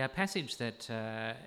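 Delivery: ordinary talk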